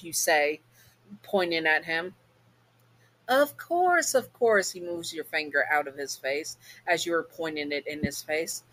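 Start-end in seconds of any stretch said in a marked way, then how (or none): 3.3-4.7 much higher, airy mock agreement; Alistair's voice. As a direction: dismiss her with breezy charm. Demon brushes off angry mom.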